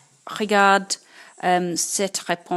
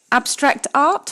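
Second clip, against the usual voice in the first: abstract art, higher and louder in mock disbelief; mock astonishment; 7 semitones higher, 6 dB louder than usual. Teacher mocking a wrong quiz answer, high and loud. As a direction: act stunned at a silly answer.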